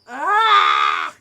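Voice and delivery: loud frustrated yell of ah; frustrated cry. A narrator's reading. Boy yelling in frustration at his tricking friends. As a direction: let out a furious yell. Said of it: "I let out a frustrated cry".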